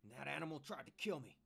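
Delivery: loud, furious accusation; angry snarl. A narrator's reading. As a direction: spit it out in anger. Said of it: "he snarled".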